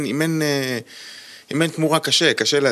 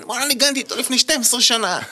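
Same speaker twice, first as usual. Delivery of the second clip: excited; 13 semitones higher, faster than usual. Retold or imitated. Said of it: "הוא אומר לי".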